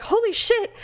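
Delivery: high-pitched mock alarm; panicking. Husband accused over a retweeted girl, mock panic. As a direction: imitate a man suddenly realizing he's in trouble. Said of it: "he started panicking".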